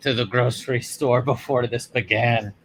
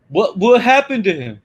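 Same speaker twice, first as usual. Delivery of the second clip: put-on raised, eager young hero voice; Anakin voice; 6 semitones higher, 5 dB louder than usual. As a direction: play it as an eager young apprentice. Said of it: "let me play Anakin".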